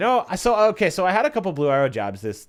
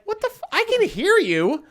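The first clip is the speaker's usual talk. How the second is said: much higher, louder, slowed disbelief; shocked; 8 semitones higher, 7 dB louder than usual. Retold or imitated. Host answering the heckler, shocked and higher. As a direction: react in stunned disbelief. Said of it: "And I was like".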